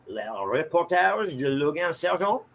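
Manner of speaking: announcer-style French pronunciation; grand French title read; French accent. Pretend.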